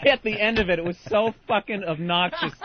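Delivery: ordinary delivery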